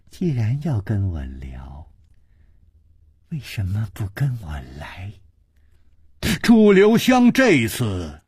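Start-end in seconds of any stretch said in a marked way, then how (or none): 0.1-5.1 soft lower coaxing whisper; whispered flirtatious invitation; teasing whisper. A narrator's reading. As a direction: lure him in a whisper. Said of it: "而且还笑了笑说".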